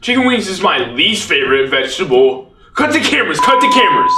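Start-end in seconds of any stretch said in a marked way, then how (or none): 2.7-4.1 shrill, rising panic; panicked shout. Acted. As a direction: yell to stop the cameras. Panicked call to stop filming.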